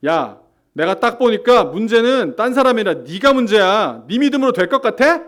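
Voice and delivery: loud, high-pitched contemptuous rebuke; judgmental scolding. Acted. Pastor acting out an arrogant believer judging another, loud and scornful. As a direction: bark it down at someone beneath you. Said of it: "한번 실감나게 한번 해보겠습니다".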